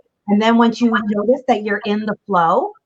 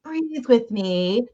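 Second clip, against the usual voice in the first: slow, soft, drawn-out soothing; exaggerated calm; 3 semitones higher, 5 dB quieter, much slower than usual. Parent to upset kid, overly calm and slow. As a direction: stretch it out slowly and softly. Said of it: "or you're just like".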